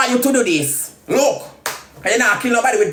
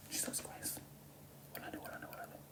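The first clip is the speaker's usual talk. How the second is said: whisper; 32 dB quieter, faster than usual. Real, not acted. Aside after insulting Rosalie.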